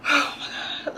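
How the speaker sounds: breathy, voiceless, despairing "oh my God"; panicked sigh. Retold or imitated. Fearing she was drugged with coke, with a despairing sigh. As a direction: sigh it out in dread. Panic over suspected cocaine.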